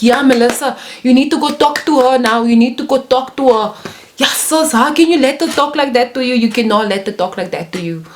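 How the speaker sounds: put-on high, insistent Coloured-accent goading; imitated Coloured accent, urgent and high-pitched; South African Coloured accent. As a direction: push a friend to act, in a Coloured accent. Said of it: "So yeah, the colored accent".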